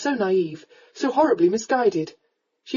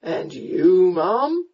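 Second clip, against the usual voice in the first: tipsy gallant query; 3 dB louder, much slower than usual. A narrator's reading. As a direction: ask with drunken courtliness. Drunk man asks her name.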